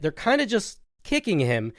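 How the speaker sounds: normal talking voice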